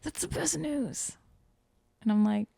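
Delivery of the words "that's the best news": hushed, high-pitched imitated glee; mock excited half-whisper, stretched at the end; retold giddy excitement. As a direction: squeal softly, imitating his delight. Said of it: "happy dance in his bed".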